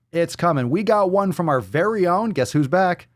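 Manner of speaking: high, excited announcement; teasing hype. Acted. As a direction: build up the big reveal. Announces returning target with hype.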